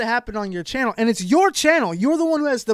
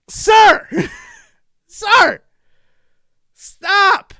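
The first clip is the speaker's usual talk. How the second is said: loud, high call of "sir"; shouting; 12 semitones higher, 9 dB louder, much slower than usual. Real, not acted. Yelling at the drama channel host, loud shout. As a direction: call him out across the room.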